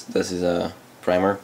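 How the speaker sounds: normal talking voice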